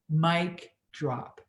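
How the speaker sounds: ordinary delivery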